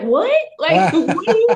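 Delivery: can't believe what you just heard, voice jumping up; mock incredulity. Actor learning a co-star left for the hotel, incredulous. Retold shock at hotel news.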